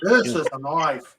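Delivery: mock Australian, back down in pitch; Crocodile Dundee. Host repeats the film line, mock Australian. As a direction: play the film hero in a put-on Aussie accent. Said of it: "Crocodile Dundee".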